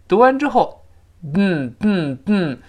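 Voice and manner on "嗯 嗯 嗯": "嗯" as short bursts released through the nose; tongue held on the ridge, push "嗯" out through the nose; nasal plosion. Shown as a demonstration. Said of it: "发现发生在鼻子里边". (舌尖顶住，嗯 从鼻腔爆破)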